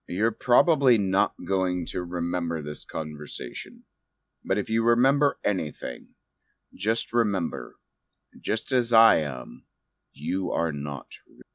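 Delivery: low, soft, measured words; hushed revelation, slowing toward the end; solemn in-character message. Acted. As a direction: speak gently and gravely, pausing between phrases. Game master voices solemn parting message.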